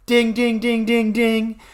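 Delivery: high, ringing repeated dings; rapid high-pitched alert dings; imitated bell. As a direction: chime like a game-show bell. Imitates a bell as idea dawns.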